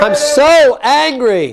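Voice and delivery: slow, high angry outburst; modelled anger. Shown as a demonstration. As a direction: stretch SO and hit ANGRY.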